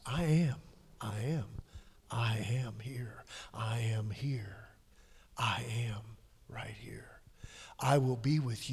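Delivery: soft, slow whisper of God's presence; whispered divine voice. Acted. Preacher whispering God's words to the congregation, hushed. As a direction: breathe each I am almost silently.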